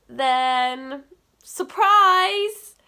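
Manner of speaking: loud, slow, high reveal; excited announcement. Real, not acted. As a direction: drag it out like a big reveal. Big announcement to viewers.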